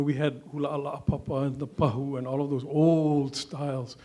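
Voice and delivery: normal talking voice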